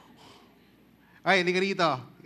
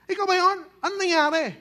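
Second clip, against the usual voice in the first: curious neighbors; 10 semitones higher, 4 dB louder, faster than usual. Acted. Neighbors questioning the healed man.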